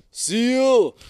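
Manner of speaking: loud high warning shout; yell. Retold or imitated. Manfredo warning the diver of a seal, shouting. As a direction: shout the alarm across the water.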